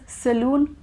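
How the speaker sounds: saloon said with a long oo, "sa-LOON"; mispronounced salon. Shown as a demonstration. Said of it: "I definitely used to pronounce it".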